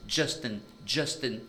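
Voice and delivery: normal talking voice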